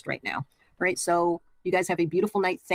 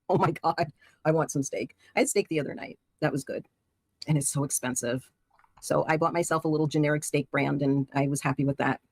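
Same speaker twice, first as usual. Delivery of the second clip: ordinary talk, nothing marked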